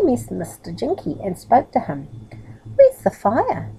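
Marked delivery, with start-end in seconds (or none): 2.8-3.7 eager question. Acted. Middle eagerly asks Jinky.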